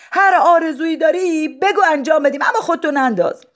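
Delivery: high, anxious begging; pleading crowd. Acted. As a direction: plead with him not to jump. Crowd begs madman.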